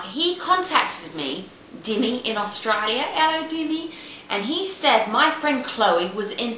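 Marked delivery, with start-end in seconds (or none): 3.0-3.9 high-pitched mock Australian greeting; Australian accent. Greeting her friend in Australia, playful mock Australian accent. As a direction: sing out a put-on Australian hello. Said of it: "that's my Australian accent".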